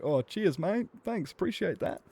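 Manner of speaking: put-on high child thanks; a boy thanking the driver for the lift; grateful kid voice. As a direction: thank the man like a small boy. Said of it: "And I was like".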